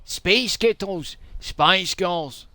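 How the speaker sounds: put-on punchy Scottish accent, trading retorts; arguing Scots. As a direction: argue outside an Edinburgh pub. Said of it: "sounds like an argument somewhere in, in Edinburgh".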